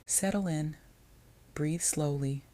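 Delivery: plain reading voice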